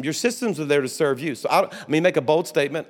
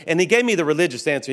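ordinary delivery

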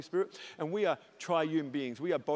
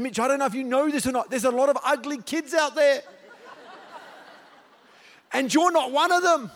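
anointed preaching voice; 8 semitones higher, 8 dB louder than usual. Retold or imitated. Preacher tells son with a sudden anointed, loud fervour. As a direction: proclaim it over your son like anointed preaching. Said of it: "then I felt the anointing kick in".

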